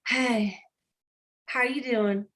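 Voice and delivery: slow it down, halting and underpowered; mock unenthusiastic greeting. Mary Kay answering the phone before getting pumped up, lacklustre. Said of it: "Okay, bad".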